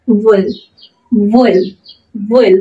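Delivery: ওয়েল with a short i-like vowel. Shown as a demonstration. Teacher models the word for learners, ওয়েল with short vowel. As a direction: say ওয়েল briefly, one word at a time. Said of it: "ছোট্ট করে একটা উচ্চারণ হবে".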